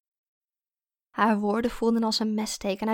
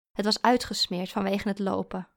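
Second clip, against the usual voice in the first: usual speaking voice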